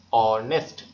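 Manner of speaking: অনেস্ট in two loud beats; split into syllables. Shown as a demonstration. Teacher showing students two syllables, অনেস্ট said loudly in two parts. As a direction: punch both syllables of অনেস্ট separately. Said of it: "অ থেকে নয়ে চলে গেছে".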